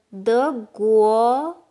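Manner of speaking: 德国 said slowly with guo on a rising second tone. Shown as a demonstration. Teacher contrasting 德国 with 苹果, slow and loud. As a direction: stretch 德国 out and lift guo. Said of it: "guo is a country, and it's second tone".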